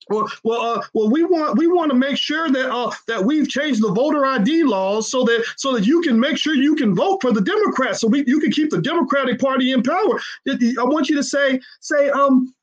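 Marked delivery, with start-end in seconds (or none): 0.1-10.2 mock politician. Mocking politicians' evasion.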